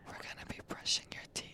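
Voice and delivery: fast hushed ASMR whisper. Acted. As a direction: whisper it into the mic.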